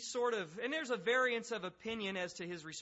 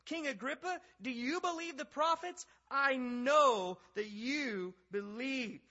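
high, loud challenge, slowing at the end; emphatic scripture reading; 8 semitones higher, 7 dB louder, slower than usual. Acted. Paul challenging King Agrippa on the prophets, high emphatic declaiming. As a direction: press the king hard, drawing out the last line.

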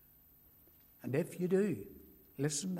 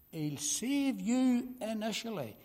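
higher, ringing repeat with YOU stressed; raised emphatic repeat; 5 semitones higher than usual. Acted. Preacher presses the promise home on his hearers, emphatic. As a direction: lift the whole line and land on "you".

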